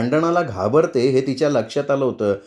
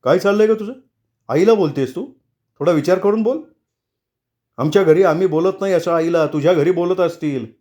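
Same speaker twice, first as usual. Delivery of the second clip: higher, half-shouted telling-off; angry scolding; 5 semitones higher than usual. A narrator's reading. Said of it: "Kedar तिच्यावर जोरात ओरडला".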